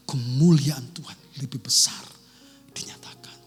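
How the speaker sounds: hushed whisper, slowed down. Real, not acted. Preacher hushes the congregation into a whisper. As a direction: let it sink in with a whisper.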